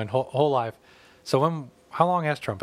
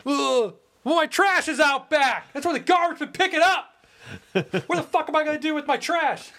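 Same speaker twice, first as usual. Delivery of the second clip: whiny complainer. Retold or imitated. Imitating a petty neighbor fretting over trash pickup, high whine. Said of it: "Someone else".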